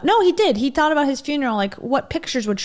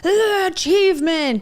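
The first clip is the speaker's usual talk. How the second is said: mock striving; 9 semitones higher, 5 dB louder, slower than usual. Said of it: "obsessed with".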